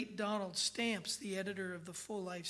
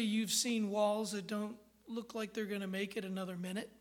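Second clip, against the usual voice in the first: ordinary delivery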